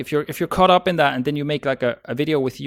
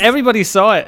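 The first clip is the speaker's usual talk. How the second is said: higher, louder mock alarm; excited; 8 semitones higher, 9 dB louder, faster than usual. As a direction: exclaim it like a panicked newbie. Mocking fear of going viral.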